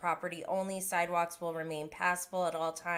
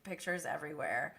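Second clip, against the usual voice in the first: ordinary talk, nothing marked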